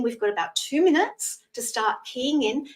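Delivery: ordinary delivery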